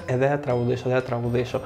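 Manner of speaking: low, soft imitation of a shy friend; mock reluctant refusal. Friend at karaoke refusing to sing, imitated low and softly. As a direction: quietly imitate someone backing out.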